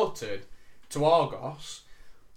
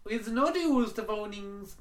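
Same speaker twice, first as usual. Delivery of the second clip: mock Indian accent; 7 semitones higher, slower than usual.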